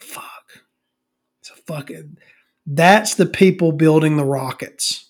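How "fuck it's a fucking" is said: whispered under the breath; dumbfounded whisper on seeing the credentials; stunned whisper. Retold or imitated. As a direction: mutter it in shock, voiceless.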